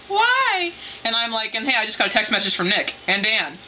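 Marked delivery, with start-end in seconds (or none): 0.1-0.7 very high, alarmed mom voice; high-pitched mock mom; imitated mom. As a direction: squeal it as a startled mom. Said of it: "And Mom's like".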